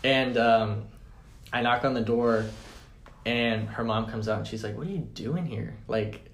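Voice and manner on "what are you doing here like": mildly incredulous, higher-pitched mom voice; retold surprised mom. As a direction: lightly imitate a mother taken aback by a visitor. Girlfriend's mom surprised at his arrival.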